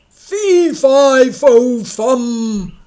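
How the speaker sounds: very loud booming roar; roaring giant. Acted. Giant chasing Jack, roaring. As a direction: roar each word like a giant. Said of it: "roared the giant".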